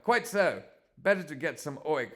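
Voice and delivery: put-on high, loud posh English accent. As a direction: play a pompous posh Englishman, pitched right up. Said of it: "Why has he got a posh accent now?".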